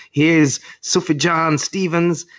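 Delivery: high, slow, drawn-out announcement; mock grand announcer. Imitating the presenter introducing [name], high and slow. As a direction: stretch the name out like a grand host. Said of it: "she goes".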